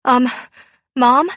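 high, loud, hesitant question; suppressed terror. A narrator's reading. Frightened daughter asking her mom, trying to hide terror. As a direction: try to sound normal over rising fear. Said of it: "trying to speak normally over the terror".

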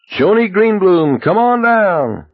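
high, loud, drawn-out host call; game show announcer. Retold or imitated. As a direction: boom it like a TV quiz host. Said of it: "Johnny Olson, the new Price is Right".

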